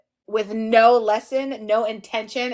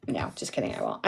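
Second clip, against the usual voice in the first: breathy whispered take-back; whispered aside; 13 semitones lower, 13 dB quieter than usual. Real, not acted. Teacher whispering to the viewers that she won't yell. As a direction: whisper it off as a joke. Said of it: "I may have to yell at you guys".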